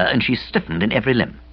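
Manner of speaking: plain reading voice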